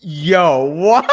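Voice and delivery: loud, rising astonished outburst; real shock, pitch climbing into laughter; stunned disbelief. Real, not acted. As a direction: blurt it out, amazed and laughing. Stunned by a bench press figure.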